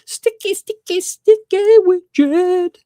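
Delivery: very high mock-excited cheer, last word stretched; playful shout-out. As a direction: build to a loud, silly high holler. Playful shout-out of member's name.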